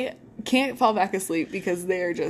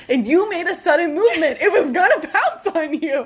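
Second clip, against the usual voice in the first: louder, higher, insistent retelling; mock urgent warning; 7 semitones higher, 7 dB louder than usual. Boy explaining why the mountain lion mattered, loud mock alarm. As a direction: hammer it home, breaking into a laugh.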